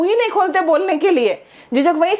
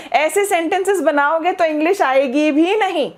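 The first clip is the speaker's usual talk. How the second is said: ordinary talk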